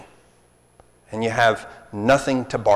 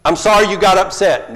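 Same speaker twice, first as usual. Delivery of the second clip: loud, higher non-apology; bad apology; 6 semitones higher, 7 dB louder than usual. Preacher voicing a daughter's grudging apology, loud and higher. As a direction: toss it off like a sulky kid. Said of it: "what are you sorry for".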